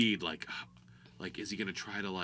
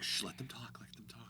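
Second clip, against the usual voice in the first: whisper; 13 dB quieter than usual. Retold or imitated. Audience hushing each other.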